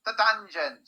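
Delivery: loud, high pronouncement; shouted order. A narrator's reading. Squinty guard sends prisoners away, shouted order. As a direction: call out the sentence forcefully.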